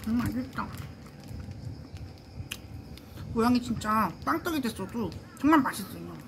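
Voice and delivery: speaking with food in mouth; mumbled between bites; chewing while talking. Real, not acted. Seen 2: Bit snack at -5; lips pressed, chewing between words throughout.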